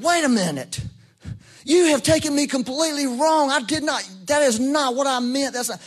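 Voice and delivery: high, insistent self-defense; indignant protest. Retold or imitated. Indignant protest to an accusing caller.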